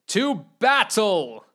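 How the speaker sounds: slow, rousing call to arms; rally the troops; drawn-out heroic cry; battle cry. Acted.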